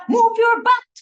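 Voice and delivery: high, loud barked command; imitating a trainer yelling at boys; mock coach yell. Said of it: "He yelled at them".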